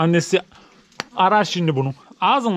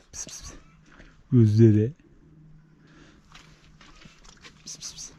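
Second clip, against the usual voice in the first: breathy pss-pss to lure a cat; whispered pss to a stray cat; whispered cat call; 6 semitones lower than usual. Real, not acted. Calling the cat.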